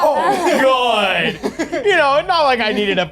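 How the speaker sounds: high, laughing relief after a scare. Real, not acted. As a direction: laugh through it, shaken. Player laughing after jump scare.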